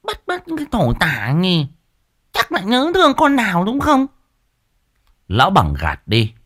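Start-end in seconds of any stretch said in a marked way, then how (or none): 0.0-4.1 high, mocking jab, much higher; pouting scorn. A narrator's reading. Wife mocks husband's unease, high sneering voice. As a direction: tease him with a sneer. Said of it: "Mụ Liên bĩu môi".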